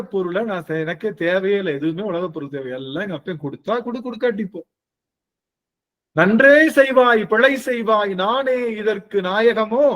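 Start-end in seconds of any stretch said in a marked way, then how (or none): 6.1-9.9 slow, louder recitation of a sacred verse; recited hymn verse. Retold or imitated. Preacher quoting a Tiruvasagam verse to the devotees, slow solemn recitation. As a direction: intone the hymn line slowly, with reverence. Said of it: "அப்படின்னு சொல்லிட்டு".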